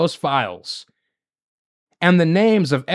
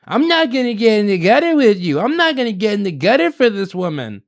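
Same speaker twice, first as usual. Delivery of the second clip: higher-pitched screechy mocking impression; annoying screechy voice; 4 semitones higher than usual. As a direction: mimic her in an annoying, whiny screech. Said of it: "with this annoying, screechy voice".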